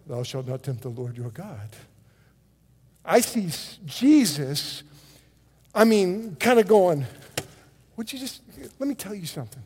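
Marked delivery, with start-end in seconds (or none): 0.1-1.7 low, soft, formal scripture recital; solemn Jesus. Acted. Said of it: "I don't see Jesus going". 8.0-9.6 quick, hushed confrontation; confrontational Jesus. Acted. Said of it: "I see it much more dramatic".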